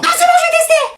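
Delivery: very high laughing squeal; shrieking surrender with a laugh. Acted. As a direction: squeal giving in under the spray. Shrieks while being sprayed.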